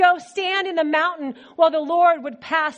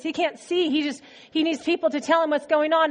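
normal talking voice

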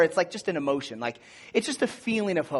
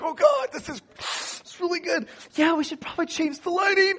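mock teary; 13 semitones higher than usual. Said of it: "full on tearing".

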